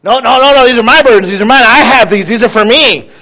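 mock-stubborn, refusing to let anyone help; stubborn refusal. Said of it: "We hang on to those burdens".

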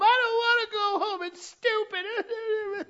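high, loud bawling protest; crying four-year-old. Acted. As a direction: whine and wail like a kid in a tantrum. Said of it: "they're crying in the back".

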